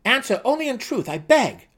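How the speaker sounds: high, loud begging gnome; pleading gnome. A narrator's reading. Seldig begs the dark elf for honesty, high and loud. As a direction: beg earnestly for the truth.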